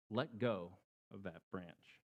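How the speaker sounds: low, soft, slow command; God quietly urging believers to let go. A narrator's reading. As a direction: gently tell them to let go. God's command echoed.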